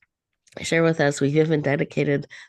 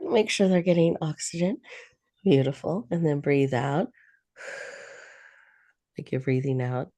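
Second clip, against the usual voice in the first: guided meditation. Acted. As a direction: ease them into calm breath by breath. Leads a guided breathing meditation.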